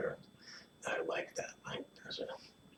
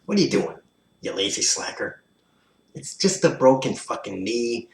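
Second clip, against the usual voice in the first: high, sneering mock yell; hammed-up bully jeering; 4 semitones higher, 5 dB louder than usual. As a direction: taunt the injured man loudly. Mocking the injured, acted.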